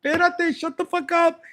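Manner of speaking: high, loud, flustered squeal; flustered viewer. Retold or imitated. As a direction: shriek it like you got caught. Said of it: "You're like".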